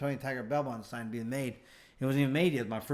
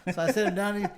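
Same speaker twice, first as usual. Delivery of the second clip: ordinary talk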